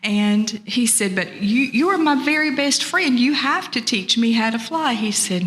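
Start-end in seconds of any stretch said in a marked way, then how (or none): 1.2-4.9 higher-pitched, insistent begging; pleading turtle. Acted. Turtle begs his best friend to teach him, pleading.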